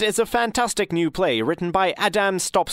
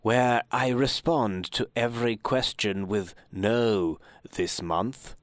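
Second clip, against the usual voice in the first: much lower, slow, softer mumbling voice; slow low caller; 6 semitones lower, 5 dB quieter, slower than usual. Acted. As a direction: drag it out, low and sleepy. Caller in the radio play.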